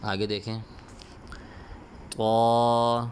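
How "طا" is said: Qaida recitation drill. Shown as a demonstration. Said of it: "جو حرف کے اوپر میں انگلی رکھتا ہوں".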